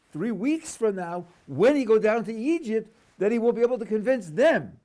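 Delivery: loud, high stress on "when he go down to Egypt"; selective emphasis. Shown as a demonstration. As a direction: punch THREE WEEKS, WHEN and THEM high and loud. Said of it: "selectively emphasize the words".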